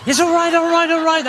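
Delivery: high, loud repeated all right; hammed-up excitement. Comic gushing at a video, high and loud. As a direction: gush it over and over. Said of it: "And it's".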